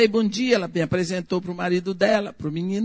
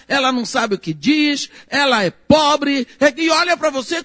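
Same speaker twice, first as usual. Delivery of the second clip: higher, louder indignant speech, slowing on the last words; indignant outburst; 5 semitones higher, 4 dB louder than usual. Retold or imitated. The neighbour rebuking the family for looking down on her, indignant. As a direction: let the hurt and indignation rise. She scolds the family about prejudice.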